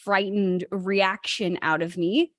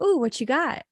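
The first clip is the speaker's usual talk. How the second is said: curious; 5 semitones higher than usual. Retold or imitated. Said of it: "I came from a place of curiosity".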